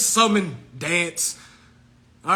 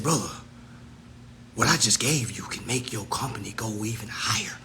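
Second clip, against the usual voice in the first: much lower whispered taunt; mocking whisper; 9 semitones lower, 6 dB quieter than usual. Taunting the rival brother about his price, whispered. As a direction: hush it like a secret jab.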